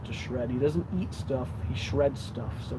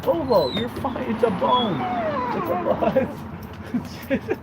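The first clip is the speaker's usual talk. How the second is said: calm the startled puppy; soothing; 11 semitones higher, 4 dB louder than usual. Real, not acted. Soothing the yelping dog.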